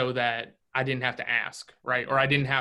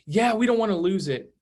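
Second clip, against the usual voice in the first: higher and louder, keen to get in; eager buyer; 6 semitones higher, 5 dB louder than usual. Retold or imitated. Buyers answering the agent at the door, eager and loud. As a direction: play the buyer who can't wait. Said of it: "'Cause that's when they're so excited".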